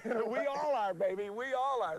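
high, gleeful and playful; excited. Real, not acted. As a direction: crow it happily, pitched high. Coach joking with player.

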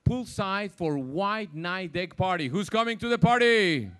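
loud, upbeat party announcement; upbeat cruise director. Real, not acted. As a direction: pump up the audience for the party.

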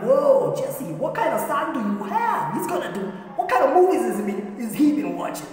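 high, excited, laughing disbelief; amazed onlookers. Acted. Crowd asks Jesse about his wild son, astonished. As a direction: gasp it out high in comic amazement.

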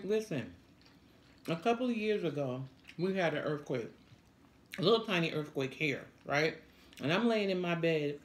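ordinary talk

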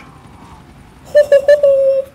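very high, loud cackling laugh; shrieking high laugh; high cackle. Real, not acted. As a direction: cackle wildly with glee. Cackling after taunt.

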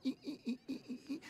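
tiny high-pitched scared "ee" noises; frightened squeaking. Retold or imitated. Scared kid wobbling on a bike, frightened squeaks. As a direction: squeak softly, high and in little bursts. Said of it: "and I'd be like".

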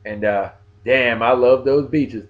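normal talking voice

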